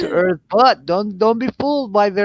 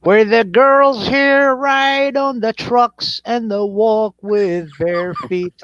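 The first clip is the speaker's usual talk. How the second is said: very slow Tennessee drawl, starting high; hammed-up country drawl; 3 semitones higher, much slower than usual. Describing Tennessee girls to friends, slow country drawl. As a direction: stretch it out like a country boy. Hamming up life in the sticks.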